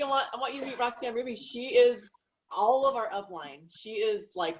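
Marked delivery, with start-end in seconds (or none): none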